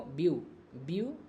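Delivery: "Beautiful" broken as view... टी... फुल; split into three syllables. Shown as a demonstration. Teacher pointing out pieces to students, "beautiful" syllable by syllable. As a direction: separate each syllable of "beautiful" with a pause. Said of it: "ये तीन टुकड़ा हुआ ना इसका".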